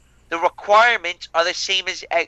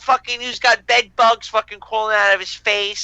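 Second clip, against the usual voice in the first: ordinary talk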